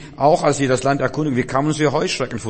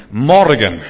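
sudden loud ringing cry; proclaimed shout; 8 dB louder than usual. Acted. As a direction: burst out with the word as God's answer. Preacher cries out God's answer. (lauter, verkündender Ausruf)

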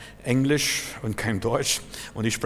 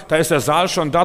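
loud, higher triumphant reply; emphatic answer; 5 semitones higher, 8 dB louder than usual. Real, not acted. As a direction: announce it with certainty. Preacher answering own question.

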